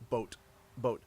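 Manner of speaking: "boat" with a long, rounded Canadian O; long held O in "boat". Shown as a demonstration. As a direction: hold the O in "boat". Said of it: "we hold the O".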